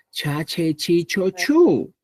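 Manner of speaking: "cha, che, chi, cho, chu" with a strong breathy puff after the ch; aspirated ch. Shown as a demonstration. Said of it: "El aspirado es".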